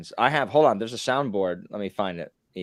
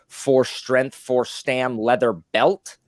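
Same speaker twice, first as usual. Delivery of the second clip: a touch louder and higher meme voice; lightly voiced meme quote; 6 semitones higher, 5 dB louder, much slower than usual. Host quotes a WoW soundboard meme, lightly voiced. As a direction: call it out like a raid meme. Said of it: "Another one was".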